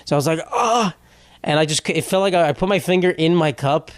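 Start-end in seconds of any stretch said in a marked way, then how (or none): 0.5-0.9 short high pained "Ah"; retold pain cry. Vlogger reliving the burn, short high yelp.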